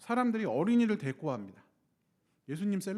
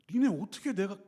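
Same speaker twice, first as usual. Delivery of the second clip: Jesus angry; 4 semitones higher than usual. Retold or imitated. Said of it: "하고 화를 내시는 거예요".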